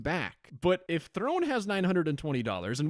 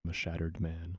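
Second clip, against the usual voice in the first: mock devastated; 12 semitones lower, 7 dB quieter than usual. After being fooled.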